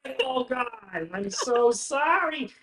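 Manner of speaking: very high, flustered fretting; Bartleby fussing. Acted. Bartleby frets at Paxton over crying Branwen, very high. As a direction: fret high and loud at a friend. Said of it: "He goes".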